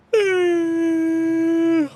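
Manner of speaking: loud drawn-out yawn; big yawn. Real, not acted. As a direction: let out a long noisy yawn. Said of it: "First yawn".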